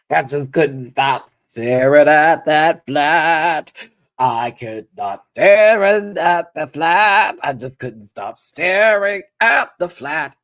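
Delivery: slow, loud, drawn-out disbelief; exaggerated dismay. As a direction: stretch each phrase out, hammed-up and loud. Mock dismay at a flat shoe.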